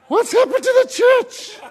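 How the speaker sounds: high-pitched, drawn-out comic outcry; loud squeaky exasperation; exasperated cry. Acted. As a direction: cry it out high and slow, incredulous. Mock outcry to congregation.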